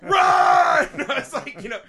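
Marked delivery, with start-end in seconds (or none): 0.0-0.1 high, loud warning yell at the screen; yelling run. Retold or imitated. To the kids on screen, a high, loud mock warning. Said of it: "And I was like". 0.1-1.0 loud laugh. Real, not acted. After his own movie joke, a loud high laugh.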